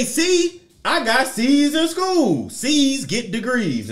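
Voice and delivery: sing-song mock excuse; mock defender. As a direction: imitate a smug excuse maker. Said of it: "Now you may say".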